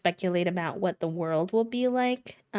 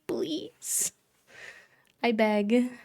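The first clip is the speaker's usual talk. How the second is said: pleading; 5 semitones higher, much slower than usual. Retold or imitated. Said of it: "And I'm like".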